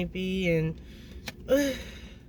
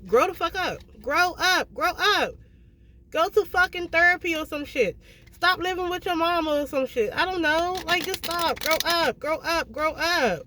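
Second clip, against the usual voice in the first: higher, insistent tough-love scolding; blunt scolding; 8 semitones higher than usual. Real, not acted. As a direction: scold him like he needs to hear it, pitched up high. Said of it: "I'm saying it like this because Levante need to hear it like that".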